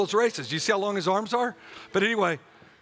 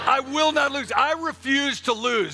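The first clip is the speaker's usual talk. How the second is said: higher-pitched, emphatic declaration; like you mean it; 5 semitones higher, 3 dB louder than usual. Shown as a demonstration. Preacher leading congregation to refuse defeat, emphatic conviction. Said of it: "Say it like you mean it".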